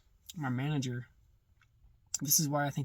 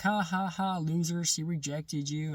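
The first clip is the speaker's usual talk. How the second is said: higher, louder mock laugh, then slow sneering taunt; 4 semitones higher, 4 dB louder, slower than usual. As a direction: imitate the voices jeering at him. Mocking voices in his head.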